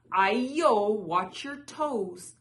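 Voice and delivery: loud, drawn-out teasing warning; crying out. A narrator's reading. Runaway rice cake dodges lion dancers, crying out. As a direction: call it out big and stretch the last words. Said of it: "It cried".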